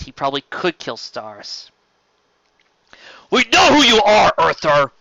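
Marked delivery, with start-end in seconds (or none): 3.3-4.9 loud, high contemptuous snarl; furious spitting sneer; spat. Acted. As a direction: spit the words at him. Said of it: "Sandoval spat".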